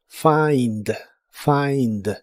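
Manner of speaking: "Find" said like "faind"; "find" with an "ai" vowel; ai sound. Shown as a demonstration. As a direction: open the I in "Find" into an "ai". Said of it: "una A entre la F y la I".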